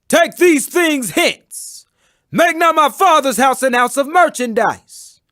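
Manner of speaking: stern rebuke. Acted. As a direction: order them out of the holy house.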